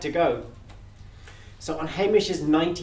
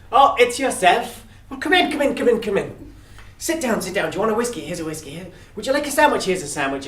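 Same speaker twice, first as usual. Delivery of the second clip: higher, eager welcoming chatter; fussy host; 6 semitones higher, 4 dB louder than usual. Acted. Hamish welcoming Death in, eager host. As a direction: fuss over your guest, high and bright.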